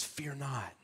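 breathy whisper of scripture. Acted. Preacher quoting scripture's most common command: soft whisper. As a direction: hush it like a secret promise.

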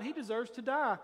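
ordinary delivery

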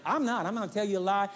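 usual speaking voice

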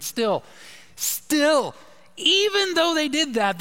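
rising, pressing emphasis on still; emphatic scripture reading. Acted. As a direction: read the verse with mounting urgency.

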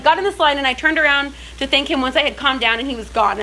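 ordinary talk

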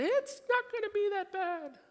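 very high sing-song self-deception; lying to oneself. Acted. Lying to yourself in a painful situation, high-pitched mock cheer. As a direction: put on a falsetto of mock reassurance. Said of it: "Even lie to yourself".

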